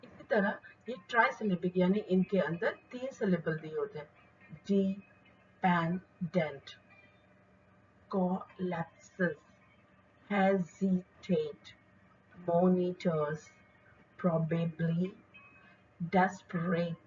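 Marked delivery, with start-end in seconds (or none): 4.6-9.4 Dependent said very slowly, syllable by syllable; slow syllable demo. Shown as a demonstration. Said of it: "इसी flow में बोलिए". 10.3-16.9 slow syllable demo. Shown as a demonstration. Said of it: "इसी flow में बोलिए".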